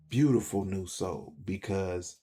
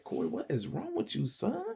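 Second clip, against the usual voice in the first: higher, softer puzzled father's question; imitating dad; 12 semitones higher, 4 dB quieter than usual. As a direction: mimic a worried dad asking his son. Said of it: "My dad was like".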